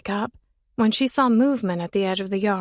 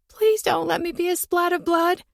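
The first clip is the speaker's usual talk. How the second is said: wailing plea; 9 semitones higher than usual. A narrator's reading. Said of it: "She wailed at the sound of that".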